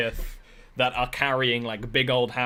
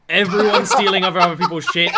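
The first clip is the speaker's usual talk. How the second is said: laughing; 4 semitones higher, 7 dB louder, faster than usual. Real, not acted. Same words said twice.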